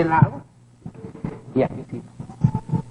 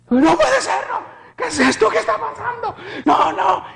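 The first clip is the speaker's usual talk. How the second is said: high-pitched panicked disbelief; pánico revivido. Retold or imitated. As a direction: cry out in frightened denial. Acts out his panic, retold. (pánico agudo, casi gritado)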